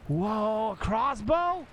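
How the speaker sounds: high, amazed exclamation; surprised delight. Real, not acted. Player finding a crossbow in the game, surprised delight. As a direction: jump up in pitch, astonished at the find.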